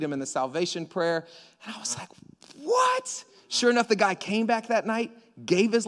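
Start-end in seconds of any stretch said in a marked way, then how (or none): 2.6-3.2 sudden high squeak of disbelief; high startled what; shocked what. Retold or imitated. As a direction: react in total disbelief. Retold shock at news.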